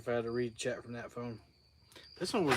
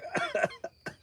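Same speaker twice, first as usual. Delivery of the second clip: hacking cough to clear the throat. Real, not acted. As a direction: cough the bug out. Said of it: "I ate a bug".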